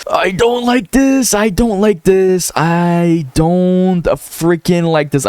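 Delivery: high, slow, anxious pleading; nervous whine. Real, not acted. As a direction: dread the collapse, drawn out and high. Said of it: "I'm nervous right now".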